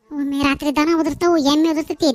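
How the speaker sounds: loud, building angry list; complaining to the neighbour about her animals' noise; angry shouting. Acted.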